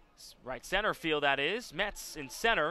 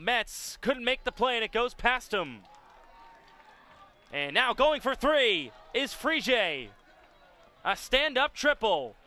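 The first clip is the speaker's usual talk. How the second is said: higher and louder as the triple unfolds; rising play-by-play excitement; excited call; 8 semitones higher, 6 dB louder than usual. Real, not acted.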